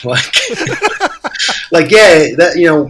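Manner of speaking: real laughter breaking through; can't stop cracking up; laughing. Real, not acted. Laughing while telling friends the story.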